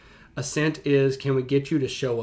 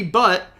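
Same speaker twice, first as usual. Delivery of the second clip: "but" said loud and emphatic; stress on but; 6 semitones higher, 8 dB louder than usual. Shown as a demonstration. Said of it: "capital B".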